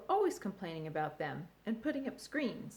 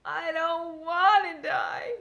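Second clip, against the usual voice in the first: higher, loud, slow wailing shout; crying out; 9 semitones higher, 10 dB louder, slower than usual. A narrator's reading. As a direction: throw the words out in despair. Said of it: "cried Wilbur".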